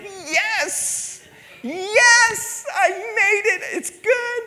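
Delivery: very high, squealing near-tearful cheer; relieved joy. Retold or imitated. A young waiter finding the stall clean, squealing with very high relief. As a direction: squeal it like a reprieve.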